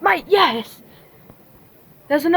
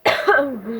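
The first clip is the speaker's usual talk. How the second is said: choking cough; coughing fit; 3 semitones lower than usual. Real, not acted. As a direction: cough like something went down wrong. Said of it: "I'm choking on s".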